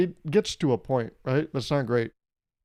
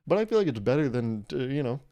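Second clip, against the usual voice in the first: usual speaking voice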